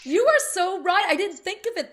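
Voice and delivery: high, loud excited agreement; light up with realisation; thrilled sudden agreement. Real, not acted.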